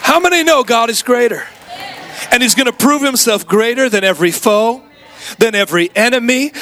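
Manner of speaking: rally the congregation; fervent preaching. Acted. Preaching at full force.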